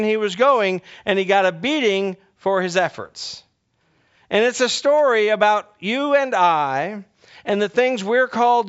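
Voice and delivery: high, loud, driving sermon; preaching at full force. Acted. Preacher rousing the congregation about the donkey's warning, loud and high. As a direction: build the congregation up.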